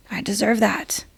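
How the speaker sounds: breathy whisper to herself; whispered affirmation. Shown as a demonstration. Telling herself she deserves it, in a whisper. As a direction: whisper it like a secret promise.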